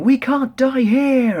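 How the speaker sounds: high, slow desperate scream. Retold or imitated. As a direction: drag it out, yelling against fate. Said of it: "like, screaming".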